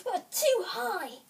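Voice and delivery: high-pitched little objection; Goldilocks complaining. A narrator's reading. Goldilocks trying Father Bear's bed, complaining.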